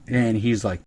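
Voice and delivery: usual speaking voice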